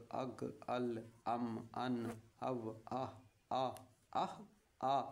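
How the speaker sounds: slow, even recitation of each sound; teacher chanting the alphabet sounds; syllable drill. Shown as a demonstration. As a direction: sound out every syllable clearly for pupils. Teacher drills Urdu syllables aloud.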